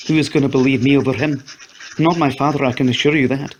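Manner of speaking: nobody would believe her; bitter hopelessness. A narrator's reading. Annie explaining to Lloyd her father won't listen, bitter.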